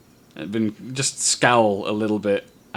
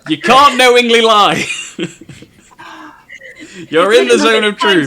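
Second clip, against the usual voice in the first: laughing reminder; 13 semitones higher, 11 dB louder than usual. Real, not acted.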